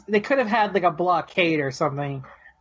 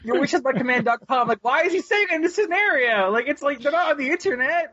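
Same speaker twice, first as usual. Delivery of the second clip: pitched-up disbelief; high exasperated rant; 9 semitones higher than usual. Real, not acted. Fan ranting about nonsense dialogue, high exasperation. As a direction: rant in amused frustration.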